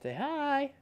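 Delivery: high dog-talk voice. Real, not acted. Voicing to dog.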